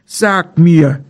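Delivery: a lightly voiced bear, asking urgently; bear calling out. A narrator's reading. Bear asking the deer Maral for help, lightly voiced. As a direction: call to the deer as a worried bear. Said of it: "rief der Bär".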